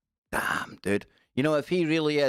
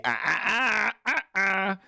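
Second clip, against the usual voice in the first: high, sung-out ahs; high angelic ahs, hammed up; mock heavenly choir; 8 semitones higher than usual. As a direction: play the light of heaven opening up. Mimics going towards the light.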